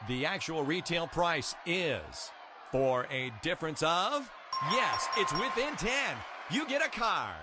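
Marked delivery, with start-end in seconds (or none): 4.7-7.3 excited win. Acted. Win announced in game.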